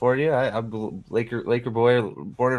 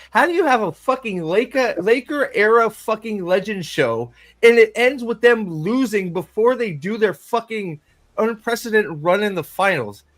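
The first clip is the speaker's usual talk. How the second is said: exasperated rant; 7 semitones higher than usual. Real, not acted. Fan ranting to a friend about a cancelled show, exasperated. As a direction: rant in outrage at the finale.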